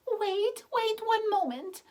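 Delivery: put-on very high, loud call of an old woman; frantic high cry of the old woman; cried out. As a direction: call out high and urgent to stop her. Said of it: "cried the old woman".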